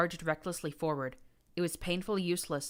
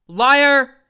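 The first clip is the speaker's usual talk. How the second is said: high, very loud roar; 10 semitones higher, 21 dB louder than usual. A narrator's reading. Prince roaring liar as he stabs her. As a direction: roar it as you strike. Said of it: "he roared".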